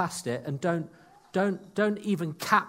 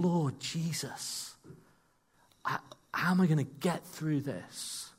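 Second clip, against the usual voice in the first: desperate plea; 3 semitones higher, 3 dB quieter than usual. Retold or imitated. Desperate prayer in a storm.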